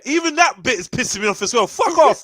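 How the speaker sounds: loud angry rant. Pretend. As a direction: rant at them with fury. Role-play ex ranting.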